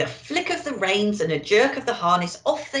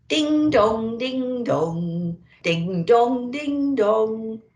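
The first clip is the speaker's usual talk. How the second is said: sing-song ding dong like church bells; lilting imitation of church bells; bell chimes; 4 semitones higher, 3 dB louder, much slower than usual. Faint bells ringing in blizzard.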